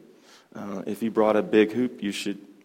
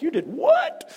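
mock shocked squeak, much higher; incredulous; 5 dB louder, slower than usual. New Yorkers hearing his driving route, incredulous squeak. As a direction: pitch it way up in disbelief.

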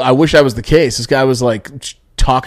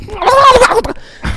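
a far higher, squeaky mock raccoon screech; imitated raccoon noise; 5 dB louder than usual. Teller imitates a raccoon screeching near the tent, very high. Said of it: "one near our tent just going like".

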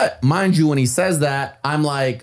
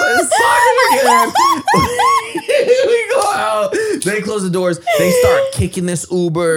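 much higher, loud, laughing through words; 3 dB louder than usual. Real, not acted. Telling friends the wild ending, laughing.

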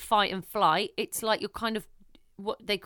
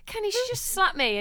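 mock upset; 11 semitones higher than usual. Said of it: "I'll be like".

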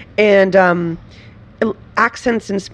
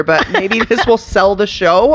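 ordinary talk

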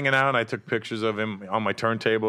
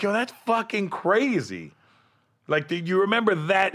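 amazed excitement; 9 semitones higher than usual. Retold or imitated. Amazed at Tyson's memory.

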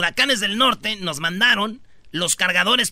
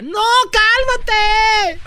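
stretched, much higher mock woman's whine; mock girlfriend; 13 semitones higher, 5 dB louder, much slower than usual. Host imitating drunk girlfriend refusing, stretched high. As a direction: drag out a high whiny no. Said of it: "y ella".

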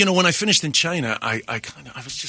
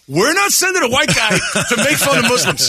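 mock network exec; 7 semitones higher, 3 dB louder than usual. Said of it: "television networks were like".

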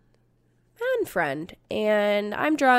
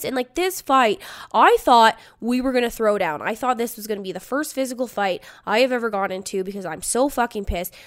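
plain reading voice

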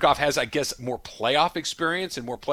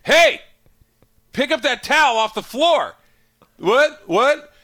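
much higher and louder, fed-up shout; dad yelling at kid; 12 semitones higher, 9 dB louder, slower than usual. Retold or imitated. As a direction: bellow it like a dad who has finally snapped. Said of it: "you go crazy".

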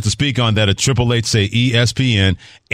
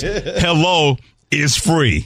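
slow, high, drawn-out hello is free; slow emphatic quote; 7 semitones higher, much slower than usual. Retold or imitated. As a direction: stretch each word out. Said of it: "Harry Douglas says".

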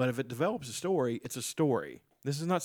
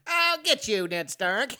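much higher, louder cartoon villain cackle; mustache twirling villain; 10 semitones higher, 6 dB louder, slower than usual. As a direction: squeal a gleeful villain threat. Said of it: "Look at me being all dastardly".

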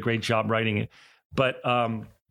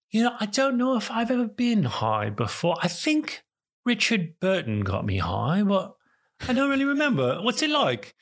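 high, put-on old English gentleman voice; Michael Caine impression; 9 semitones higher than usual. Michael Caine asking what being high is like. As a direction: wonder aloud like Michael Caine. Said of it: "Michael Caine stops and he goes".

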